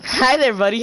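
loud, high excited call; excited shout. Real, not acted. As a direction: yell it out on spotting him. Spotting a friend in game.

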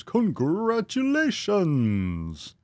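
high, slow, stretched congratulations; long, high, cheering congratulations; drawn-out cheer. Real, not acted.

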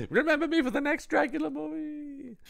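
tiny, high-pitched soft voice; mock parting plea. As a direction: call out a feeble, squeaky farewell in jest. Bit player's parting joke.

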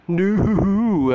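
loud, high drawn-out "no"; wailed no. Real, not acted. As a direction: wail in dismay at the lag. Reacts to getting lagged.

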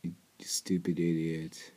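hushed unvoiced jab; whispered insult. Real, not acted. Viewer whispering an insult at the screen. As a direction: whisper the insult under the breath.

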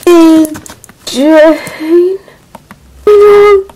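high, loud repeated cries of her name; desperate shouts. Acted. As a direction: scream for her, rising panic. Rushing to Jane, shouting.

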